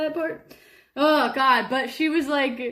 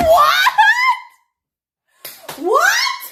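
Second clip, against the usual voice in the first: much higher, louder rising "What?"; shocked disbelief; 10 dB louder than usual. Retold or imitated. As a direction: shriek it in total disbelief. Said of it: "And I was like".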